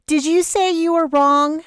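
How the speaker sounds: put-on high, disbelieving young woman; surprised Vanessa. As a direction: lift it up in disbelief.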